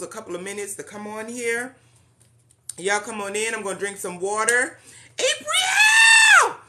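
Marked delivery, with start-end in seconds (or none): 5.2-6.6 high, loud excited call of a name; excited shout. Real, not acted. Host calling out to her coach joining the live, thrilled shout.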